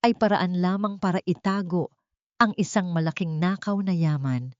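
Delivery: ordinary delivery, nothing marked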